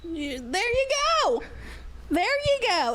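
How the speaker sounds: mock hyped agreement. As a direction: cheer him on in jest.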